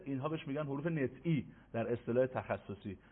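ordinary talk

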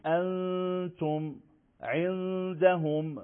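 انتم and عندهم drawn out slowly with a nasal hum; ikhfa demo. Shown as a demonstration. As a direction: hold the hidden n in انتم عندهم. (انتم عندهم با غنهٔ کشیده)

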